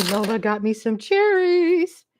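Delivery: ordinary talk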